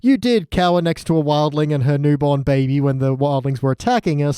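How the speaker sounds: ordinary delivery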